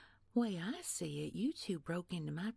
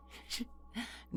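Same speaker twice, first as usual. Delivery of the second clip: breathy mocking chuckle; 6 semitones higher, 5 dB quieter than usual. Villain's mocking laugh.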